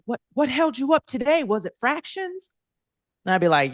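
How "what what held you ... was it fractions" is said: imitating kids. Pupils quizzing their teacher, in a high kid voice. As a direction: play the nosy nine-year-olds, up high.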